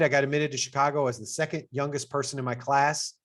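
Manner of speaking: ordinary talk, nothing marked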